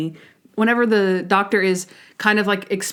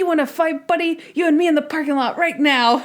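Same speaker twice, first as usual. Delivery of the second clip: high, belligerent mock challenge; cranky old man picking a fight; 7 semitones higher than usual. Old patient challenging Monk, mock-belligerent. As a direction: play a crotchety old man itching to brawl. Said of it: "who starts picking a fight".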